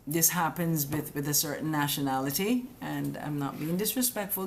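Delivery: usual speaking voice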